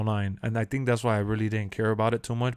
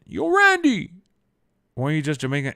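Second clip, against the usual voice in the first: loud, much higher shout of the name; mock yell across the ring; calling out; 5 semitones higher, 6 dB louder than usual. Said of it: "and he goes".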